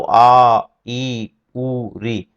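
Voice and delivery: आ ई उ री held three beats; slow prolonged vowel demo. Shown as a demonstration. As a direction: draw each vowel out long. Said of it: "प्लुत को करें".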